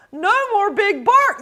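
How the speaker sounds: high, cheering yell; high crowd yell; gleeful yell. Acted. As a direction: cheer him gone. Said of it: "the crowd yelled".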